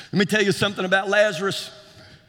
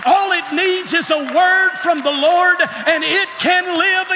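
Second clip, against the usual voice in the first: preaching build; 5 semitones higher than usual. Acted.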